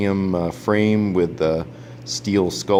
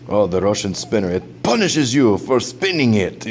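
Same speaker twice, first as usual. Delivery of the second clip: Dimitri; 3 semitones higher than usual. Retold or imitated. Reviewer imitating his Russian friend's warning, mock Russian accent. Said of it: "my friend Dimitri, he said".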